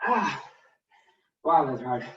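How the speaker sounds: breathless and straining; out of breath from the squats. Real, not acted. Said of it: "my legs are burning".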